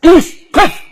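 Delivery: high, loud halt order; forceful command. Acted. Powerful man ordering the driver to stop, forceful shout. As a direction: punch out each word with full power. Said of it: "با قدرت میگه، خیلی هم قویه".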